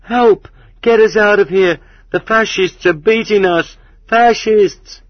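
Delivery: shrill, whining cries for help; thieves' shrill hysterical shrieking; hysterical yells. A narrator's reading. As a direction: yell in panic, very high and loud. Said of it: "hysterical yells of thieves".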